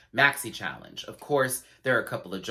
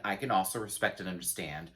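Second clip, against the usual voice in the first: normal talking voice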